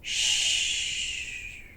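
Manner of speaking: soft unvoiced shh to the children; hushing shh. A narrator's reading. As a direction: hush the room like a sleeping bear is near. Hushing the listeners.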